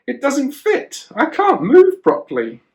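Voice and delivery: put-on a young David, squeaky and frustrated; boy's complaint. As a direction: whine like a boy swamped in armour. Voicing David in oversized armour.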